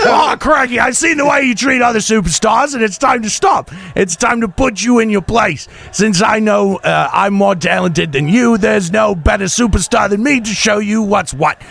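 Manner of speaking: put-on Australian accent. Promo read to a rival wrestler, hammed-up Australian accent. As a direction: ham up an Australian heel calling out a rival. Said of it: "That was Australian".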